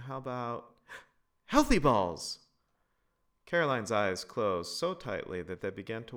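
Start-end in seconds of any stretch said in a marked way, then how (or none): 0.1-2.5 put-on slow, louder bright child's idea with a gasp; gasp, then offer it like a delighted kid; excited little-girl pitch; eager girl. A narrator's reading. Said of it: "eager child with new idea".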